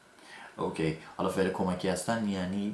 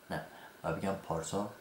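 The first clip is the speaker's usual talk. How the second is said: normal talking voice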